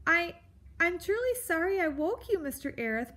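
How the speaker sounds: high, anxious little mouse voice; timid apology. Acted. Mouse apologizes for waking the grump, nervous and high. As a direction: stammer the start, then hurry the apology.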